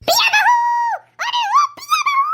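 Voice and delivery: mother-in-law hollering for her bahu; calling out loud. Acted. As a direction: shout for the daughter-in-law.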